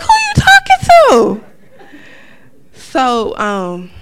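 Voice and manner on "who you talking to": much higher, louder laughing outrage; hammed-up incredulous shriek; shocked high squeal. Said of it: "looking at him like".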